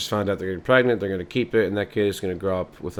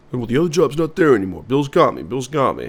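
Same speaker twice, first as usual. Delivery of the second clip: higher, louder insisting; defensive husband; 5 semitones higher, 5 dB louder than usual. Acted. Father insisting to wife Bill has him, higher. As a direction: dig in, stubborn and pitched up.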